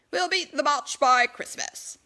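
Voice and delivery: loud, boastful declaration; declaring. Acted. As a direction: proclaim it to the crowd with bravado. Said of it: "Edward declared".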